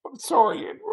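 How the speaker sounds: breaking off, overcome by the memory; choked up. Real, not acted. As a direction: voice catching as emotion rises. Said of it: "I'm such an emotional Italian".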